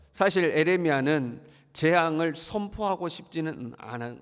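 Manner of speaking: normal talking voice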